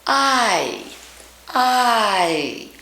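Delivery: "I" closed up at the end into its glide; careful full "I"; full diphthong. Shown as a demonstration. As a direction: finish the vowel by closing the mouth. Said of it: "close it up at the end".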